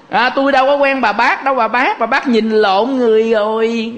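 lightly acted "who are you?" brush-off; mock denial. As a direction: act out brushing off a stranger, voice lifted. Acts out the same denial again.

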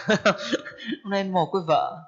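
slow line through a chuckle; laughing. Acted. Storyteller amused at the widower's lament, laughing. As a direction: repeat the husband's lament, amused and drawn out.

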